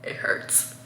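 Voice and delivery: breathy pained whisper. Retold or imitated. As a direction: whisper it, hurting. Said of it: "so I'm like".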